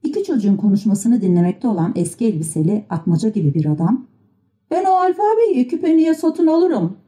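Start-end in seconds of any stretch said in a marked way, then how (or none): 4.7-7.0 high-pitched shout without much volume; calling out. A narrator's reading. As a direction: call out the offer in a raised pitch. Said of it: "diye bağırdı". (tiz perdeden sesleniş)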